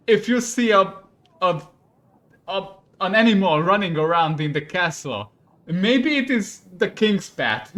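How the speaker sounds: loud, high, exasperated lecture; angry scolding. Acted. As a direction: stammer with outrage, then drive the point home. King scolds the cook.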